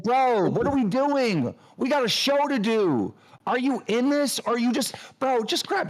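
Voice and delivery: high disbelieving demands; can't believe he's giving up; incredulous. Real, not acted. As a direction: friend scolding him in disbelief. Incredulous rant.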